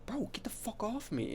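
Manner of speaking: high-pitched mock yell, kept soft. Girl yelling at the guy bothering her, high soft mock yell. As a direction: imitate an upset girl yelling, without the volume. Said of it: "she's, like, yelling at him".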